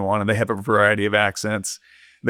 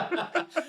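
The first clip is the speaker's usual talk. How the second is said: high burst of laughter; 11 dB quieter than usual. Real, not acted. After the heroes exchange.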